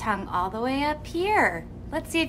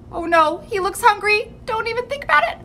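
mock alarm; 10 semitones higher, 4 dB louder, much faster than usual. Warning the pretend hungry dinosaur.

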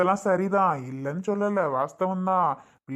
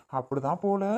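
ordinary talk

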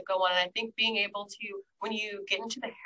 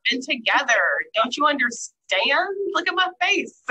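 loud, higher-pitched exasperated partner; mock indignation; 4 semitones higher, 8 dB louder than usual. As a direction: act out an indignant, demanding spouse. Acts out demanding partner expecting mind reading.

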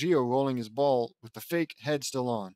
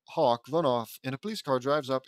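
plain reading voice

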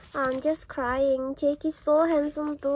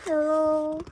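ordinary delivery

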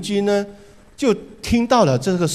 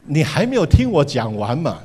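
ordinary talk

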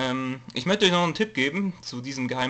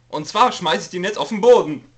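mock excited; 6 semitones higher, 8 dB louder than usual. Announcing throwing ball, hyped.

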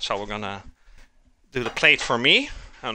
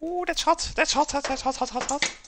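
high yelps at the heat; yelping hot; 14 semitones higher than usual. Real, not acted. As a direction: squeal hot, hot, hot. Burning on hot food.

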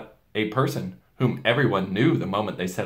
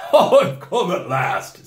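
slow, much louder joyful greeting through a laugh; laughing Father Christmas; 8 semitones higher, 8 dB louder, much slower than usual. A narrator's reading. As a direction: announce your arrival with a laugh.

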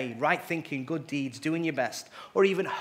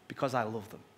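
softer, tender answer of the Father; deep and soft; 4 semitones lower, 6 dB quieter, faster than usual. A narrator's reading. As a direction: let it land gently, full of love. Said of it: "The Father's voice was deep and soft".

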